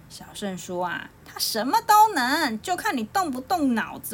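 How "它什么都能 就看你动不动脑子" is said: put-on boasting young hero. A narrator's reading. Young hero answers back, boasting.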